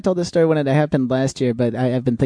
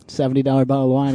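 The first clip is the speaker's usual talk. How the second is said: ordinary talk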